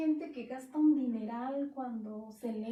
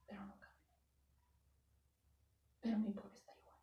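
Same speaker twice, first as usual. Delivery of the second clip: hushed voice of the Lord; 3 semitones lower, 8 dB quieter, faster than usual. Retold or imitated. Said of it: "el Señor me dice".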